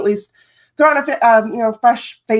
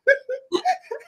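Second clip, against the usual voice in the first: light high laugh; amused laugh; 4 dB quieter than usual. Real, not acted. Host laughing at her son's tears, light high laugh. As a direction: laugh at the absurdity.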